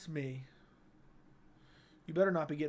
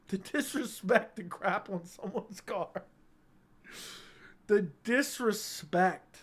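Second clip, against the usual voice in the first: slow, drawn-out mock indignation; mock outrage; 2 semitones higher, slower than usual. Streamer to chat about the car prank, slow mock outrage. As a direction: savour the word disrespect, very slowly.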